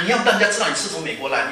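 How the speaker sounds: normal talking voice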